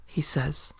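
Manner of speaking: ordinary delivery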